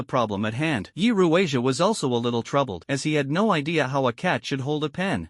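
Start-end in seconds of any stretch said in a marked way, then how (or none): none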